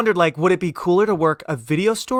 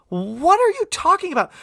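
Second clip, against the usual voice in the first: incredulous; 12 semitones higher than usual. Retold or imitated. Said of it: "even my dad looked at her".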